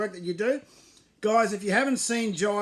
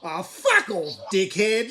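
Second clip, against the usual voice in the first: laughing insult; 4 dB louder, slower than usual. Acted.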